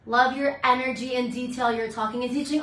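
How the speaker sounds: ordinary talk, nothing marked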